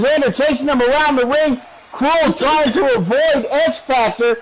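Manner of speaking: live play-by-play. Real, not acted. Announcer calling a ring chase for viewers, excited play-by-play.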